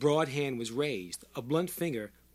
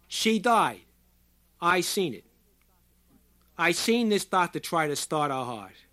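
loud, high testimony; roaring; 4 semitones higher, 6 dB louder than usual. A narrator's reading. Short man accusing the doctor before a crowd, roaring. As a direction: shout each statement over a wailing siren. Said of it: "His voice was a roar, easily overriding the penetrating shriek".

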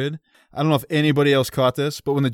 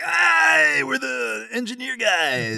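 put-on German accent; 11 semitones higher, much slower than usual. Farm boy calls to the German sailors in a shouted German accent. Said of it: "perfect German accent".

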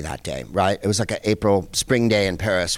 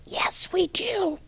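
whispered, much higher and breathless; hushed whisper; 7 dB quieter, much slower than usual. Retold or imitated. Diners answering the German lady in a slow whisper. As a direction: whisper it so the star won't hear. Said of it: "And we're like".

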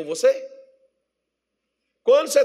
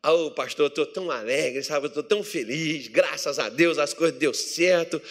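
mock happy churchgoer; 6 semitones lower than usual. Church member telling the pastor good news, mock contented.

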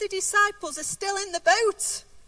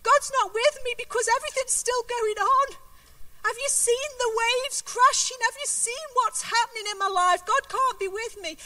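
anxious doubter; 5 semitones higher than usual. Retold or imitated. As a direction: voice the doubter who feels God has gone. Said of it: "sometimes we think".

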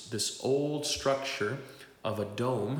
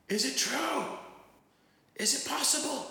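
shout back. Retold or imitated. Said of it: "he shouts back at me".

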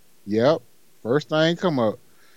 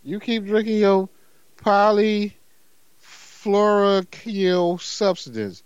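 slow careful reading; 4 semitones higher, much slower than usual. Shown as a demonstration. Reading the lawsuit chemical name to his friend, slowly. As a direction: sound out the long chemical word slowly. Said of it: "I gotta go slow".

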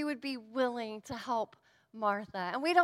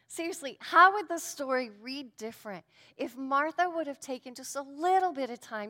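ordinary talk, nothing marked

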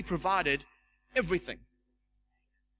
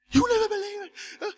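much higher and louder, bursting with the news; freaking out; 6 dB louder than usual. Retold or imitated. Woman rushing to tell the elder her healing news, high excited burst. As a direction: squeal it like you can't hold it in. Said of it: "completely freaking out".

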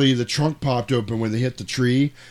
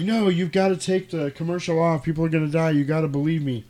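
desperate plea; 6 semitones higher than usual. Retold or imitated. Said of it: "And he's like".

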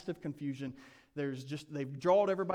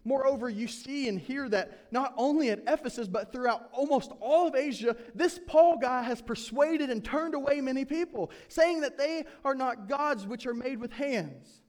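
heated reading; 7 semitones higher, 7 dB louder than usual. Acted. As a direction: voice rising high and loud as Demetrius stirs the craftsmen. Demetrius rouses the craftsmen.

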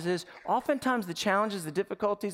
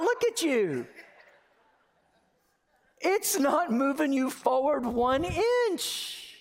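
high playful voice of God; God amused at a hamster on a wheel; God gently teasing; 8 semitones higher than usual. Acted. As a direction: tease the tired believer like a fond parent.

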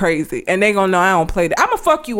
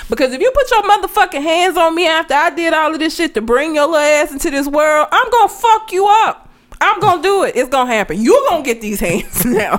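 mock mother threat; 6 semitones higher than usual. Imagined mother warning her child.

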